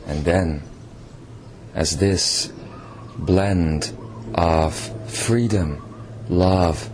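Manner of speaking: guided meditation. Acted. Channeled king leading listeners into meditation, soft guiding voice. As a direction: ease the listeners into letting go.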